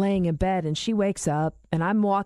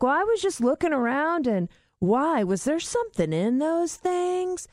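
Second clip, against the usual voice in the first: innocent dad voice; 8 semitones higher than usual. Retold or imitated. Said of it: "He's like".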